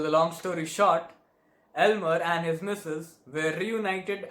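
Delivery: animated, dramatic storytelling; usher's breathless-with-drama account; flustered theater usher. Acted. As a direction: relive the commotion with embarrassed amusement. Performs a comic stage monologue.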